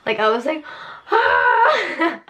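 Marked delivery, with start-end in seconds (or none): 1.1-2.2 high, brief mock scream; hammed-up "ah" breaking into laughter; retold scream. Woman recalling waking up in pain, mock scream. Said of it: "like, screaming".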